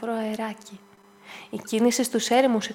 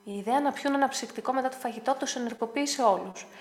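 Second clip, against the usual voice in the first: ordinary delivery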